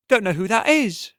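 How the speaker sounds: a brassy woman demanding what's wrong with him; sharp tavern woman. Acted.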